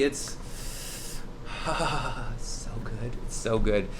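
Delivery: sighing, slow and soft savoring; hushed food rapture. Real, not acted. Host raving about the flatbread to customers, hushed swoon. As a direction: drop to a blissful, drawn-out hush.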